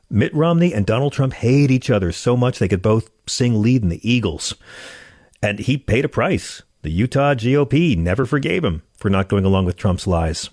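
ordinary talk

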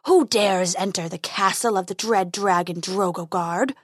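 low, menacing dragon voice; growling dragon guarding treasure. Acted. Dragon voice in story.

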